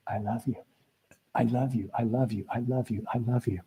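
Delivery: quiet, gentle repeats of I love you; soft repeated affirmation. Shown as a demonstration. Coach modelling mirror work, soft and steady. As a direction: say it softly again and again. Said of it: "repeating mirror affirmation".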